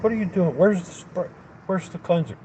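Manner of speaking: high-pitched incredulous questions, retold; exasperated questioning. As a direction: fire off exasperated questions as you retell it. Challenging worker smearing grease with rag.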